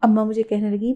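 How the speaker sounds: ordinary delivery, nothing marked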